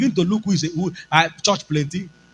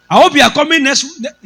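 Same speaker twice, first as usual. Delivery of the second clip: loud, fast, higher warning; stern boss; 8 semitones higher, 7 dB louder, faster than usual. Retold or imitated. Boss ordering the pastor to come next week, loud fast. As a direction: rattle off the warning. Superior warns.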